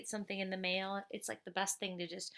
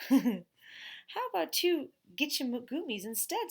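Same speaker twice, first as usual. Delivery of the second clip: put-on higher, slow wheedling boy voice; sly little dealmaker; 6 semitones higher, 6 dB louder, slower than usual. A narrator's reading. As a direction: pitch the deal like a tiny salesman. Boy bargaining like businessman.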